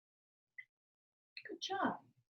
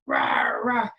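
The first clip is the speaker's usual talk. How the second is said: deep shouted mock roar, much lower; hammed-up roar; lion roar; 5 dB louder than usual. Shown as a demonstration. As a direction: growl it out like a big lion. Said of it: "What sound do lions make".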